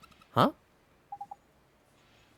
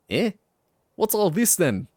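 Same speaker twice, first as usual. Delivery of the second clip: louder, higher Welsh greeting; Welsh Drippy calling out; 7 semitones higher, 6 dB louder than usual. Acted. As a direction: call across in a Welsh lilt. Drippy calls to Oliver.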